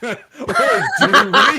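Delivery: loud, high, through laughter; laughing. Acted. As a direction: laugh the line out.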